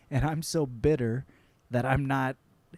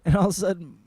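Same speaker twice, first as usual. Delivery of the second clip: ordinary talk, nothing marked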